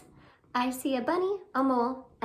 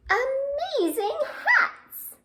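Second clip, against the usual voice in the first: high and slow, savoring it; delighted reveal; 11 semitones higher, 3 dB louder, slower than usual. A narrator's reading. Storyteller reveals the puppets were hats, delighted. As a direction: land the funny reveal for the kids.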